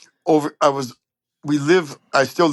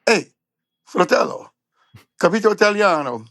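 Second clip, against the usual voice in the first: higher-pitched, friendly Italian-speaking trumpeter; Johnny Adino; 8 semitones higher than usual. Retold or imitated. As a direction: call to a fellow Italian, pitched up. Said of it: "turns around and says".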